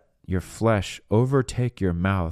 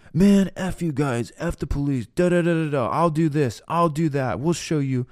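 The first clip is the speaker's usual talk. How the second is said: angry taunting; 7 semitones higher than usual. Retold or imitated. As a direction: sneer it like a furious kid. Angry kids cursing at police.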